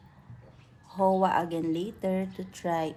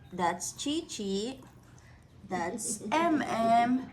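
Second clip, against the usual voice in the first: normal talking voice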